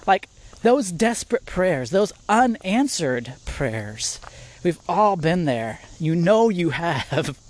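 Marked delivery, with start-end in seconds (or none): none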